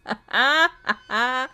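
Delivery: loud, rising burst of laughter; incredulous laugh. Real, not acted. Gamer laughing at getting the bad ending, incredulous laugh.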